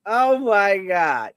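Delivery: slow moan breaking into a high laugh; can't stop laughing; drawn-out laughing fit. Real, not acted. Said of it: "I got some serious issues".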